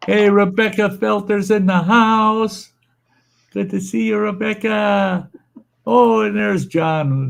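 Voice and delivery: high, stretched-out welcome; drawn-out greeting. Real, not acted. Streamer welcomes viewers into the house, drawn-out greeting. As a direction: hail a new arrival, slow and high.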